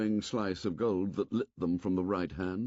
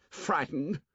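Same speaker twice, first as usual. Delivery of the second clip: sneering; 8 semitones higher than usual. A narrator's reading. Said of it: "Jack sneered at him".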